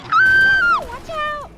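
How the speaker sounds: very high, loud warning cry; alarmed shout. Real, not acted. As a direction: yell a sudden warning. Nearly hits someone.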